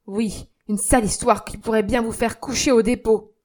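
loud, hard scolding; stern threat. Acted. Young man to concierge, loud stern threat. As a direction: keep him cowed.

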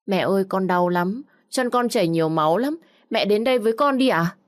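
put-on small boy pleading, mildly tearful; tearful little boy. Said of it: "tiếng khóc của Nhược Đông".

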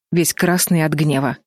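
usual speaking voice

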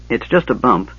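plain reading voice

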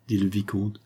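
ordinary delivery

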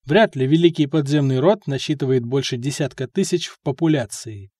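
usual speaking voice

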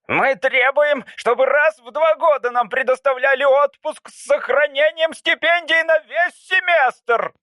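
student leader pressing demands; Anteater's demands. Acted. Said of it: "продолжал Муравьед". (студент громко и высоко требует)

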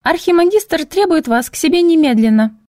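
unnaturally high, squeaky little voice. Acted. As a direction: put on a fake girly squeak. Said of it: "неестественно высоким писклявым голоском".

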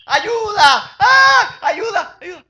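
high-pitched desperate yell; shouting for help. Acted. Blind man calling out for help in the street, shouting high and loud. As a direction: cry out for help at the top of your voice. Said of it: "El tipo estaba gritando".

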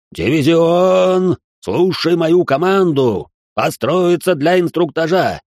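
loud, high parade-ground command; drill sergeant. A narrator's reading. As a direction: bark orders at the troops. Lieutenant commands crab division.

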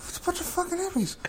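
high, fast mock disgust; imitating DiCaprio. Savouring the actor's line: high fast mock sneer. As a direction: echo the drunk sneer at hippies. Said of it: "the way he said it".

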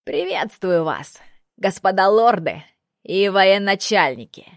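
put-on young, ringing herald. A narrator's reading. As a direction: call out a greeting to the castle walls. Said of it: "на удивление молодой и звонкий".

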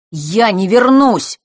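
stubborn final declaration; firm. A narrator's reading. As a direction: plant each word, no arguing. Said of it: "твёрдо сказал Фэш".

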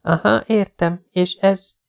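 put-on skeptical young woman. A narrator's reading. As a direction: doubt the pills. Jessica skeptical. (kételkedő női hang)

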